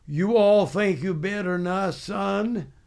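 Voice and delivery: mock Southern drawl from a drill sergeant; Southern accent. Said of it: "he spoke with a Southern accent".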